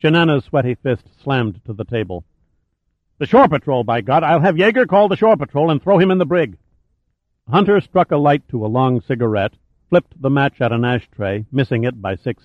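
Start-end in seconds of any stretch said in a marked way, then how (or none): 3.2-6.6 raised-pitch, blustering outrage; irate officer demanding an arrest; angry threat. A narrator's reading. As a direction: bluster angrily, pitch climbing as you go. Enraged officer slams fist on table.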